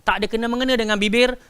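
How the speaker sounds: ordinary talk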